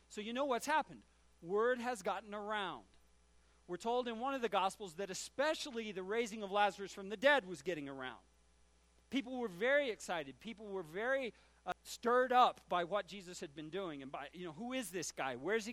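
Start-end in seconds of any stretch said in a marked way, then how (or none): none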